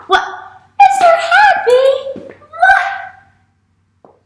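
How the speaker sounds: very high, loud, drawn-out squeal; startled squeal. Pretend. Teacher greets the sleepy puppet, squealing high. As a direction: squeal it out in mock astonishment.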